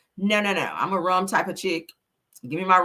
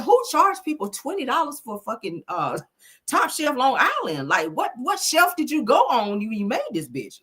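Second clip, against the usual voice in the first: heated retold complaint, higher pitch; retold indignation; 6 semitones higher than usual. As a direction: act out being mildly outraged at the bill. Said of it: "I'm still heated about that shit".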